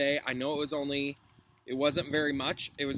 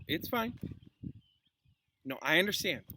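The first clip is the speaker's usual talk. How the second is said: ordinary delivery, nothing marked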